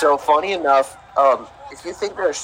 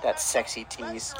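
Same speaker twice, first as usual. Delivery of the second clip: slow, soft, lower mock seductive purr; mock sultry; 3 semitones lower, 9 dB quieter, slower than usual. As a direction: tease it like a lounge crooner. Mock seductive remark about wrestler.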